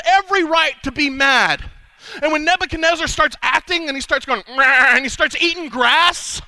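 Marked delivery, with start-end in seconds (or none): 4.5-5.0 animal bleat. Acted. Mad king bleating.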